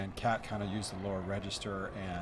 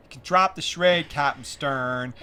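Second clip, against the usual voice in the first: put-on loud, slow, pitched-up kid reading; child actor's audition line; 5 semitones higher, 12 dB louder, slower than usual. Young girl auditioning for Princess Leia, slow and loud. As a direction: drag out Captain Stern like a nervous young girl.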